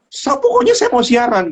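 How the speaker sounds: put-on a stressed boy demanding to broadcast; angry demand. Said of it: "Marah-marah".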